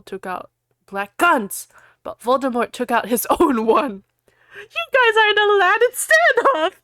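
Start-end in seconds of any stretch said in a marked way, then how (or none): 4.5-6.7 high, loud hammy plea; mock desperate shout. Ebony shouting at the gunmen, hammy desperate. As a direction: cry it out like a panicked heroine. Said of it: "I shouted desperately".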